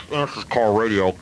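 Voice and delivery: crackly two-way radio reply; car radio voice. Acted. Caller answers the duty officer, car radio voice. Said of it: "I talked like this for a while".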